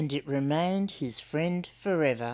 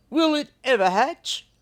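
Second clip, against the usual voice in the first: higher, louder doubtful emu; fretting bird wondering; 8 semitones higher, 6 dB louder than usual. Acted. Emu worries.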